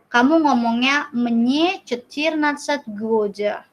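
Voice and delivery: usual speaking voice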